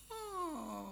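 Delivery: high, very soft sympathetic sigh of a word; wife watching the runner cry, a high soft moved sigh; imitating wife's sympathy. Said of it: "she's going".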